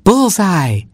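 high, triumphant exclamation; gleeful chuckled cry. A narrator's reading. Mockingbird hits cat with cake, high gleeful cry. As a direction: crow it up high. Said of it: "chuckled the mockingbird".

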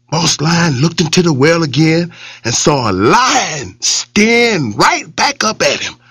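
ordinary delivery

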